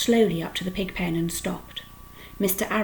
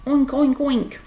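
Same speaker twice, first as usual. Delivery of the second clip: 1 mock pig oinks; 7 semitones higher, 6 dB louder, slower than usual. A narrator's reading. Boy imitating a pig.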